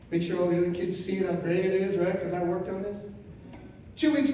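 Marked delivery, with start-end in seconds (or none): none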